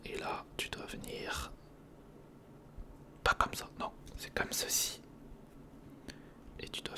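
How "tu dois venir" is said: ordinary talk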